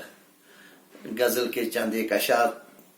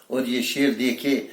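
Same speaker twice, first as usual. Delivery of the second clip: ordinary talk, nothing marked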